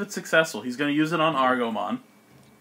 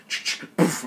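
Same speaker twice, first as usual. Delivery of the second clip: spat-out chugging burst and puff; ch-ch then an airy poof; imitated shooting noise; 3 semitones higher than usual. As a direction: voice the shot as mouth noises. Imitating the creature's shot.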